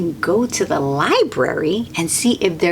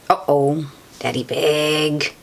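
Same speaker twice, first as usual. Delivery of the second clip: plain reading voice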